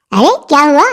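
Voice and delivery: usual speaking voice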